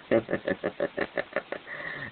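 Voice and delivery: soft whispered sinister snicker; a quiet, wicked little laugh under the breath; wicked laughter. Real, not acted. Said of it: "sorry for the wicked laughter".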